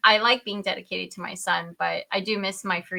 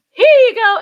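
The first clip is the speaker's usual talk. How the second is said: high, loud sing-out; exaggerated announcing voice; cheery midwife handover; 12 semitones higher, 15 dB louder than usual. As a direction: call it out like a midwife presenting a baby. Voicing the midwife handing baby over.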